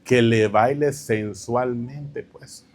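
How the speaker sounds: embarrassed. Real, not acted. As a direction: let the word out reluctantly, slowly. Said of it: "me da pena decirle lo otro".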